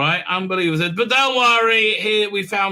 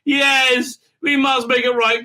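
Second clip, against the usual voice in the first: overacted vow, rising higher; mock impassioned cry; 4 semitones higher than usual. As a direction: build to a hammed-up outcry. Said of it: "cries Birdman".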